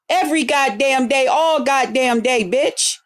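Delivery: ordinary talk, nothing marked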